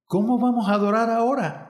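quick, high-pitched quoting back; mildly acted incredulous echo; echoed question. A narrator's reading.

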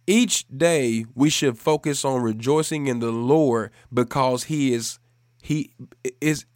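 emphatic, weighty proclamation with long pauses; preaching. Real, not acted. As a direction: hammer each phrase home to the congregation.

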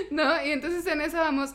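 ordinary talk, nothing marked

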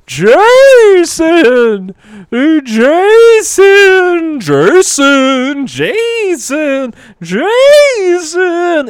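loud, high, drawn-out wailing call; haunting voices. Acted. Voices in Jason's head calling him, haunting wail. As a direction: wail the name like ghosts. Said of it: "Oh, the voices are going".

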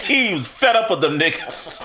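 ordinary talk

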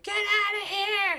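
high, drawn-out squawked yell; crow yelling. Retold or imitated. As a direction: screech it slowly like an angry crow. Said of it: "crows are gonna be like".

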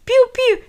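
high chirpy piu piu; baby chick peeps. Retold or imitated. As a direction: peep like a tiny chick. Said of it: "pew pew".

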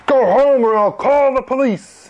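put-on irate official sending kids away; angry doorman. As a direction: order the children off sharply. Doorman orders the children away.

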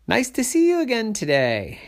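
high, cheerful fish voice; trickster tale voices. Acted. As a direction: chirp it like a friendly fish. Fish greets tortoise.

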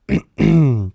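throat-clearing cough; choking cough. Real, not acted. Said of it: "je m'étouffe avec une pistache".

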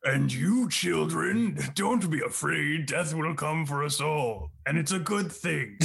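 slow solemn assembly speaker; grim reaper voice. Pretend. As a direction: preach calmly about death to kids. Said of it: "You sound like a Grim Reaper giving a school assembly".

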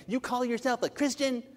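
high-pitched, judgmental challenge; mock indignant accusation.

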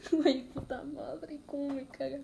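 choked up and tearful; fighting back tears, voice breaking; after crying. Real, not acted. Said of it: "Ya, ya estoy mejor". (con la voz quebrada tras llorar)

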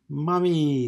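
high, loud shout of Mommy!; a kid yelling Mommy! to greet her mother; child crying out. Acted. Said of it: "Sterling cried".

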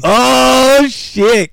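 high, loud startled cry; genuinely floored by the gift; a squeal of real surprise; shocked. Real, not acted. Reacts to duck gift.

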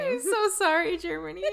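high-pitched, laughing sorry; laughing apology. Real, not acted. Podcaster apologizes to Germany for butchered words, laughing. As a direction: apologize through high giggles.

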